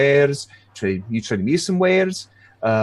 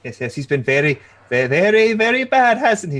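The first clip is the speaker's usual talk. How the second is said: put-on scolding old lady; 7 semitones higher, 3 dB louder than usual.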